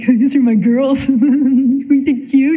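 hammed-up giddy gushing over the kids; giggly mock proud-mom squeal. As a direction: show them off, bubbling with laughter. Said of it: "I get giddy".

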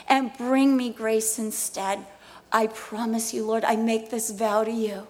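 fervent prayer. Real, not acted. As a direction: plead and promise.

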